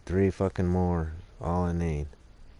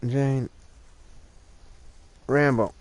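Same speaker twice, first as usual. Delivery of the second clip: calling the dogs; 6 semitones higher, 4 dB louder, slower than usual. Real, not acted.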